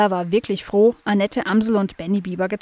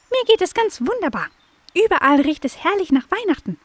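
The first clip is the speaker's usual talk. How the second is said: very high chirpy blackbird; high bird voice; 10 semitones higher than usual. Acted. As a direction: squeak it like a tiny bird. Blackbird character voice.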